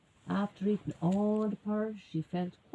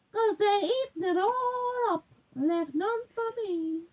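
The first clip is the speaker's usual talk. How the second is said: high-pitched mock crying; tearful little bear; 11 semitones higher than usual. A narrator's reading. Little bear left with no porridge, tearfully wailing. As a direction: wail it high like a wronged cub. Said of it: "And the little bear says".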